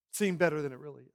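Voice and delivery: usual speaking voice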